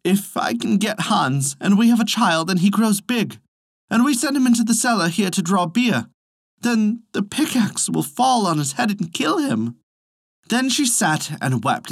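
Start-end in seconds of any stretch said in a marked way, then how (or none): 0.0-9.8 raised, higher anxious lament; a touch of weepy dread; tearful worry. A narrator's reading. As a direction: fret aloud, on the edge of tears. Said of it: "began to weep and said".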